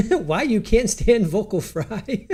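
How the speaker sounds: reads the title through a laugh, slow and high; giggling out the question; amused, laughing read. Real, not acted. Laughing while reading video title.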